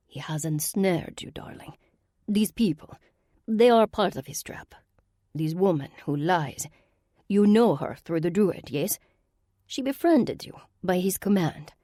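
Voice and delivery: put-on an aristocratic villain in a Russian accent; Russian-accented sorceress.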